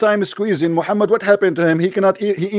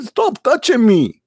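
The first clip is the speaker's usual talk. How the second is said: drawn-out whiny plea, pitched up; mock protest; 3 semitones higher, much slower than usual. Mocking Muhammad pleads with the angel, slow and whiny. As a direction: beg him slowly to let go.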